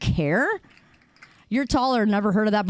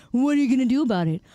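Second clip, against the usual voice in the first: fast taunt; much faster than usual. Shown as a demonstration. Repeating taunt in varied ways.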